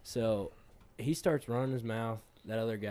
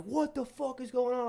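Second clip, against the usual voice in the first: mock bouncer yell; 13 semitones higher than usual. Bouncer demanding answers.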